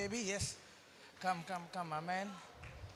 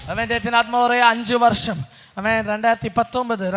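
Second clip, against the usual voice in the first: usual speaking voice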